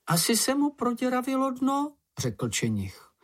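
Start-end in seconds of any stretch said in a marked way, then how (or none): 0.1-2.0 very high little creature; squeaky storybook animal musing aloud; small creature voice. A narrator's reading. Čenich guesses why the pond shrank, high creature voice.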